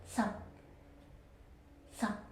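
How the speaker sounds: sup low and clipped; low checked tone. Shown as a demonstration. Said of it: "The ninth tone is sup".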